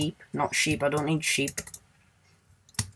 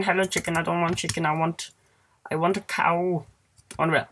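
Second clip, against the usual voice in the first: ordinary talk, nothing marked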